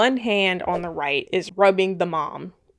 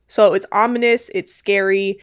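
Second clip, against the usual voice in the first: ordinary delivery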